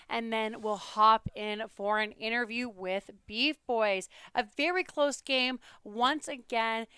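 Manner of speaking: usual speaking voice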